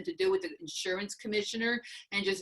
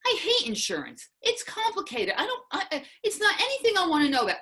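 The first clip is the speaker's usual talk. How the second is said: heated refusal; 4 semitones higher than usual. Retold or imitated. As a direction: push back harder and harder until it nearly breaks. Retold heated refusal to husband.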